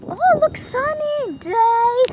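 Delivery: high, loud, slow sing-song irony; mock cheer. Joking to viewers about the rain, mock cheerful. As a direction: announce the sunshine as if it's a joke.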